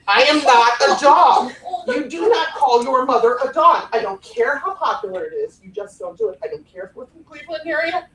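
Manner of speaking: indignant mother laying down the law, emphatic; firm, exasperated telling-off, playfully acted; mock scolding. Mother scolding her college kid for calling her dog, mock indignant.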